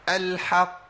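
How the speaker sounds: الحق with the final qaf held long; extra-long stopped qaf; strongest qalqala. Shown as a demonstration. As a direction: give الحق double-qaf time. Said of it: "بدي زمن زيادة شوية".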